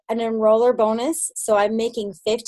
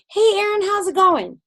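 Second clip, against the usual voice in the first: high, cheery hello; bright phone greeting; 9 semitones higher than usual. Retold or imitated. Calling a teammate on the phone, in a high cheery greeting. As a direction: greet her like a good friend on the phone.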